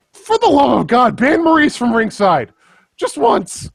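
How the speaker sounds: high, loud exasperated pleading; desperate pleading. Real, not acted. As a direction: beg the GM at full pitch. Pleading rant to the GM.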